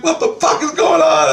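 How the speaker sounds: very high, loud mock-panicked yell; re-enacted freak-out at full pitch; retold panic. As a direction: blurt it out in high alarm. Retelling his panicked reaction in dream.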